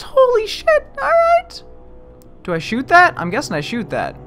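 usual speaking voice

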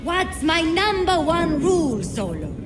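high-pitched scolding, dropping on the name. Acted. Bar owner telling off a troublemaking regular, high scolding. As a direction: scold like you've said it many times.

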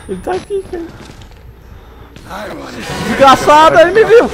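high frantic screams of fright; panicked screaming. Real, not acted. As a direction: shriek in real panic. Chased by monster in game.

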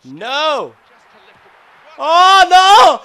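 high, loud cries of disbelief; gamer's anguished scream; real dismayed shout. Real, not acted. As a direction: yell in agony at the conceded goal. Opponent scores against player.

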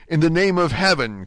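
loud, high dramatic cry. A narrator's reading. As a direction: call out in urgent appeal. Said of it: "cried Dante".

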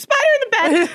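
high, mock shriek with laughter; retold scream. Guest voices partner quoting the scream back, mock shriek. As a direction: mimic someone's panicked scream. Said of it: "You just screamed".

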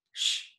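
hushing "shh"; warning shush. A narrator's reading. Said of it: "Miss Williams warned".

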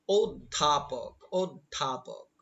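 অধ্যাপক slowly as "od-dha-pok"; pronunciation demo. Shown as a demonstration. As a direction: sound out অধ্যাপক in long syllables, "od-dhaa-pok". Teacher models slow pronunciation.

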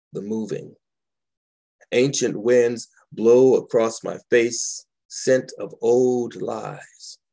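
calm, measured poetry recital; gentle spoken-word reading; poem reading. Acted. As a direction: savor each image as you read. Reads second poem aloud.

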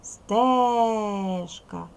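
Стежка with the е held very long and loud; stressed first syllable. Shown as a demonstration. Teacher finding the stress for pupils, Стежка with long loud е. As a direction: stretch Стежка as стеее-шка to mark the stress. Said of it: "Ставлю наголос".

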